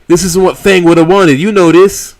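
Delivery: high, loud, urgent pleading; frantic and worried. Acted. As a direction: grab her attention in a panic.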